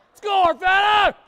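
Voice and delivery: high, very loud rallying shout; belted-out cheer from the booth; real yell. Real, not acted. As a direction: yell it at full volume to urge on the team. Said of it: "That was me yelling on the mic".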